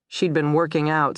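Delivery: ordinary delivery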